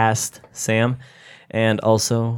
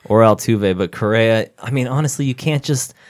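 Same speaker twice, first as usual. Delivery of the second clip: normal talking voice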